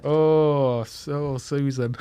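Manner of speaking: a hint of a Yorkshire accent, slow and laughing; northern accent. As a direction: say it slowly in a lightly put-on Leeds voice. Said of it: "Faster, louder".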